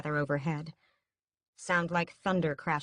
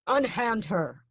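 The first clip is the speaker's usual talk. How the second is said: commanding shout; 5 semitones higher, 5 dB louder, slower than usual. A narrator's reading. Raoul commanding the guards.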